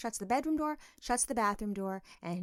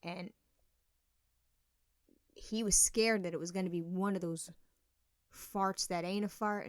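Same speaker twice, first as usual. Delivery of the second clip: usual speaking voice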